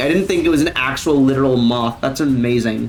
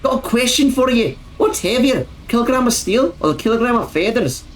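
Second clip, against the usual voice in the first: imitated Scottish comedian, higher pitch; Scottish accent; 6 semitones higher than usual. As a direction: put on Limmy's Scottish accent. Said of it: "with a Scottish accent".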